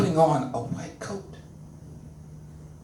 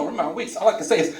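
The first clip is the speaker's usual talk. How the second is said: usual speaking voice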